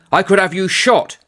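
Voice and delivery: a hint of a menacing major; mock threat.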